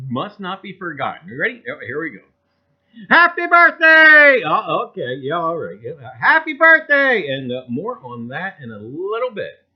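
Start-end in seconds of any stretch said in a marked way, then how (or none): 3.1-4.4 loud, higher, slow happy birthday cheer; birthday shout. Real, not acted. 6.2-7.3 louder, higher birthday cheer; birthday shout. Real, not acted.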